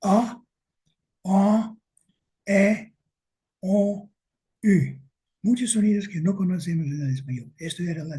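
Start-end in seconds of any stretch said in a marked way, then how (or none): 0.0-5.0 "A, A, E, O, U" leídas una por una, claras y algo más altas; vocales del otomí. Shown as a demonstration. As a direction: pronuncia cada vocal de "A, A, E, O, U" por separado, con pausa. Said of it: "Lo voy a leer". (vocales otomíes dichas despacio, una a una)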